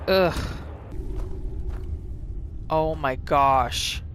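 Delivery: usual speaking voice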